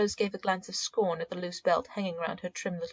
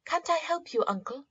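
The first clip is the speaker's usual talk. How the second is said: put-on girl's voice; 9 semitones higher than usual. A narrator's reading.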